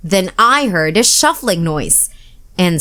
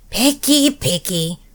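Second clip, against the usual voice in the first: complaining; 3 dB quieter than usual. A narrator's reading. Monster grumbling at the fussy boy, mildly complaining. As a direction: grumble it under your breath. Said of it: "Herbert complained".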